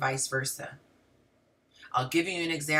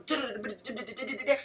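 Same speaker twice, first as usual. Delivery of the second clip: rapid-fire "da-da-da" babble, quieter; babbled chatter; 4 semitones higher, 7 dB quieter, faster than usual. Acted. Speaker mimicking how women's thoughts branch off, rapid babbling. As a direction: rattle off nonsense syllables as endless chatter.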